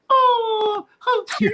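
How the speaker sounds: very high falsetto squeal; cooing women. Retold or imitated. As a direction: gush in a mock girly falsetto. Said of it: "a couple chicks pulls up".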